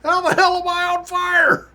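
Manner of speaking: very high, loud squeaky disbelief; high squeaky mock coach demanding an answer; baffled coach. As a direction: squeal it in outraged confusion. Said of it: "And he's like".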